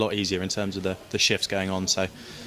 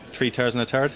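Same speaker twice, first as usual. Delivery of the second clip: put-on thick Irish accent, mumbled; 3 semitones higher than usual. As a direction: try out the Irish phrase in an Irish accent. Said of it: "any Irish phrases".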